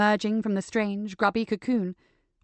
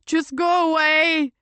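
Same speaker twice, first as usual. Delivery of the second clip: cracking, slow and high, close to tears; 7 semitones higher, 6 dB louder, slower than usual. Acted. Patrick begging the inspector to leave, voice cracking. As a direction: let the voice break on it. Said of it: "His voice cracked".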